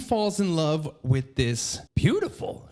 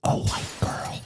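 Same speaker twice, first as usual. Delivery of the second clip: whispered. Real, not acted. Whispered reveal.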